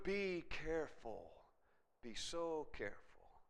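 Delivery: slow, soft caution; hushed warning. Real, not acted. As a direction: urge care softly, drawing the words out. Grave warning to congregation.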